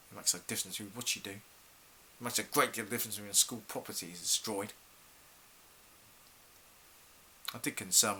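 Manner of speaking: soft, low, regretful admission; quiet, disappointed teacher opening up; subdued confiding. Acted. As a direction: drop the voice and confide lost hopes. Teacher confides lost hopes to pupil.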